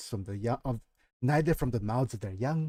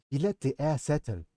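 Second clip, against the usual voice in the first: ordinary delivery, nothing marked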